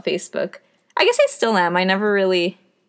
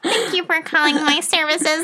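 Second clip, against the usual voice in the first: nasal Disney voice; 7 semitones higher than usual. Retold or imitated. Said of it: "my nasal Disney voice".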